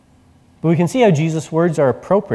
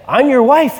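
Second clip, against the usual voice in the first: amused wife; 11 semitones higher, 5 dB louder than usual. Retold or imitated. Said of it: "And it's like".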